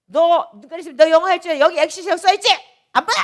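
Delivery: high, loud, fast furious tirade; imagined furious yelling. Retold or imitated. Pastor acting out her old self yelling at a foreigner, fast and furious. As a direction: rant at him as if ready to fight. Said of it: "막 그러고 난리를 쳤을 거야".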